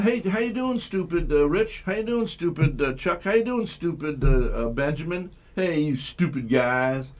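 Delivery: high, sing-song fake-cheery roll call of insults; mock friendly greeting. Host mocking rap talk by greeting friends, high mock cheer. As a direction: greet each friend brightly, then slip in the insult. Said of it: "Would you say that?".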